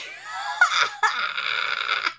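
long high cackling laugh; real mocking laugh. Real, not acted. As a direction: laugh at the failed villain. Laughs at villain.

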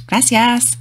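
usual speaking voice

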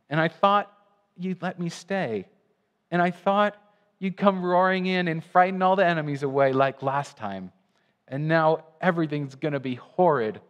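put-on a little girl on the edge of tears; childish distress.

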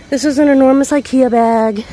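breathless after carrying heavy bags; out of breath. Real, not acted. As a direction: talk while catching your breath. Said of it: "I'm out of breath".

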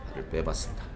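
ordinary talk

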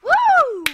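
high, loud cheering "woo"; excited whoop. Real, not acted. Player celebrating a good move, loud high whoop. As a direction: whoop it out at full voice.

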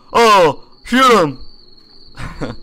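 high, loud urging; excited shout. Real, not acted. Gamer urging the shot, excited shout. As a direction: yell it at the screen.

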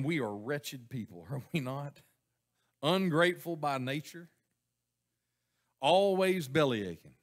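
ordinary delivery

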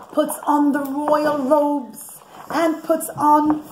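ordinary delivery, nothing marked

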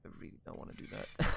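fast, whispered under the breath; reluctant whisper. Real, not acted. To the game, whispering fast reluctance. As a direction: whisper it like you're dreading the job. Reluctant aside before laughing.

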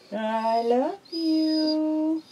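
"I love you" drawn out very slowly, high, leaning on "love"; slow drawn-out model. Shown as a demonstration. Owner teaching her pet bird the phrase, slow sing-song drawl. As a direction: stretch every word so the bird can copy it. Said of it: "Will you tell me?".

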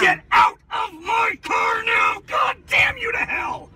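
high furious yelling; berserk rage. Real, not acted. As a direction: curse her out at full voice. Driver screams at passenger.

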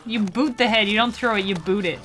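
normal talking voice

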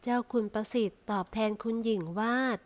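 plain reading voice

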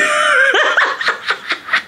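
shrieking laugh; loud high laugh. Real, not acted. Laughs after crazy.

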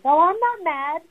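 high-pitched, put-on reassurance; singled-out high tone of mock innocence; mock not mad. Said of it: "with your tone of voice".

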